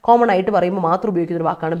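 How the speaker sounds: ordinary delivery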